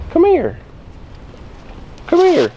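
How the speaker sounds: very high-pitched, falling beckoning call; high coaxing call. Real, not acted. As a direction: coax them over in a high little voice. Coaxing upset child over to him.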